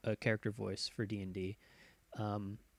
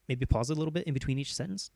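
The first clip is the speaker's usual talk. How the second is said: faster talking demo; 3 semitones higher, 4 dB louder, faster than usual. Shown as a demonstration. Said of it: "Just talk faster".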